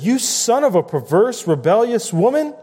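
a touch of royal rage in the reading; angry king. Acted. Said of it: "Then Saul's anger was kindled".

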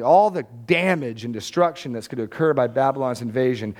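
normal talking voice

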